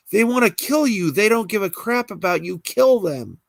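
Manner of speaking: high, pressing voice goading her; insistent goading. Acted. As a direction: keep pushing her to kill. Hallucination urging Alexis.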